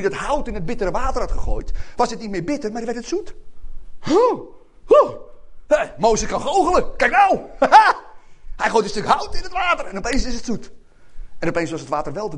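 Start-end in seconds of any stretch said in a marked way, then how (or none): 4.0-7.4 high, loud astonished gasps; hammed-up wide-eyed amazement; amazed. Acted amazed crowd at miracle. 7.4-8.5 high, loud burst of amazed laughter; hammed-up delighted cackle. Acted amazed crowd at miracle.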